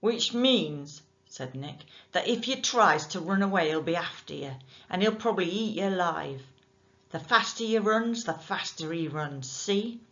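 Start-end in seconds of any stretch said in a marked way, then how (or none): 0.0-1.0 loud, rough dockside voice; menacing working man; gruff bargeman. Acted. Nick threatening. 2.1-10.0 loud, rough dockside voice; menacing working man; gruff bargeman. Acted. Nick threatens Jim.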